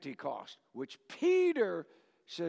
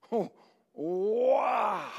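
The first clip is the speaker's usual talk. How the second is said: a loud, emotional cry of Oh, wow; overjoyed wow; 3 semitones higher, 4 dB louder than usual. Real, not acted. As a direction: let the wow burst out with joy. Recalling holding first granddaughter.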